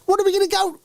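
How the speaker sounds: squeaky high cartoon mouse voice; very high falsetto cartoon character; Pinky. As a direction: do a high-pitched silly sidekick mouse. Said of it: "I'm gonna do an impression".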